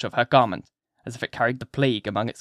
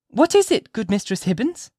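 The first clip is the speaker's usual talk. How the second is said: put-on very high, eager child's voice; little girl piping up with curiosity; 9 semitones higher, 4 dB louder than usual. A narrator's reading. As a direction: ask eagerly like a small child. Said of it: "eagerly asked little Pearl".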